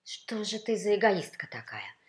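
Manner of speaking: loud, indignant reproach; scolding baritone role. A narrator's reading. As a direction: tell off a selfish rival, pressing hard. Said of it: "не унимался баритон".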